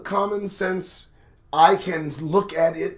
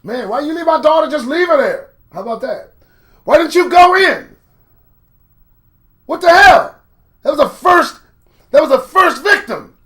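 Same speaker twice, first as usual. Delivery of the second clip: loud, high, indignant complaint; angry mother; 10 semitones higher, 11 dB louder than usual. Pretend. Mother berating police for leaving her daughter, loud and high. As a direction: rail at the officers like the mother.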